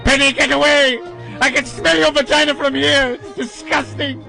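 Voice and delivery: campy shrieking melodramatic ham; hammy stage actor. Pretend. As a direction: overact every line like a coward. Continuing Doctor Smith parody.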